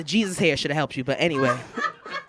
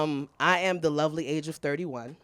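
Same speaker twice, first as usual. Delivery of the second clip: ordinary talk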